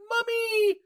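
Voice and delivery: put-on high, loud child's cry of "Mommy"; little child calling mommy. Host imitating the film's girl calling her mom, high childlike call. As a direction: pipe it up high like a kid.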